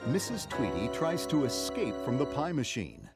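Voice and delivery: ordinary delivery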